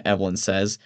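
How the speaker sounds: plain reading voice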